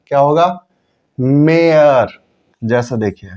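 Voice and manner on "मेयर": मेयर said very slowly with a long ए; slow drawn-out model word. Shown as a demonstration. Teacher slowly models मेयर for students. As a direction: draw मेयर out, holding the ए. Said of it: "उच्चारण हो जाएगा इसका मेयर".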